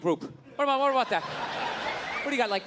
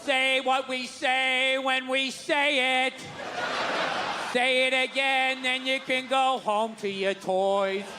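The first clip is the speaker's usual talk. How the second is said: mock Sunday school teacher; 2 semitones higher, slower than usual. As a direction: drill it in slowly like a scolding teacher. Mimics church teachers, slow loud.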